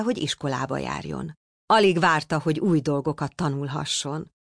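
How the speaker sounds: ordinary delivery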